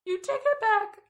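high, loud furious yelling; outraged shout. Retold or imitated. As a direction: scream it back at them. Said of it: "she just totally lost it".

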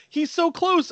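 high-pitched panicky squeal; mock panic. Host voicing Tina as the killer nears, high mock panic. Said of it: "she's like".

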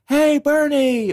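high, drawn-out mock holler; calling out. Boaters spot Bernie being dragged, high cheery calling out. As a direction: imitate a distant greeting called across water. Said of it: "you just hear people calling out".

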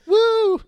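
high, loud "woo" like a wild fan; imitated fan whoop. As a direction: let out a mock party whoop. Imitating fans jumping through tables.

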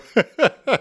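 a short high burst of laughter. Real, not acted. Teacher laughing after the road-rage demo.

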